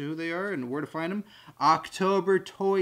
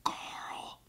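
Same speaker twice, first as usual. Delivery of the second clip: whispered imitation of a TV dad's call; Rick; 11 dB quieter than usual. Imitating Rick calling his son, whispered. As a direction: breathe the name out in a drawn-out whisper. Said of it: "He says it like that all the time".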